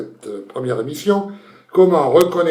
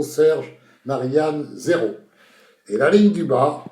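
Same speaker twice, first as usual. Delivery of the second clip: normal talking voice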